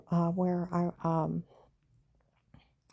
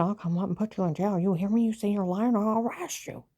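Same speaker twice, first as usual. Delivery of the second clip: raised, higher and louder threats; retold yelling; 4 semitones higher, 5 dB louder than usual. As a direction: bark it at her like an angry agent. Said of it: "he's screaming at me".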